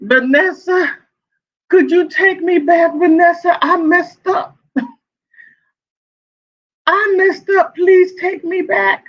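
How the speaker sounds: high-pitched, pleading fake crying; mock crying. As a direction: beg her in a hammed-up high whine. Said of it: "[name]'s, like, crying".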